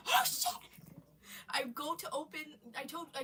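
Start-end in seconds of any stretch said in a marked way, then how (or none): 0.1-0.5 high loud scared yelp; mock panic. Both panicking at the flashing lights, scared yelp. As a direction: yelp it in fright. Monster coming.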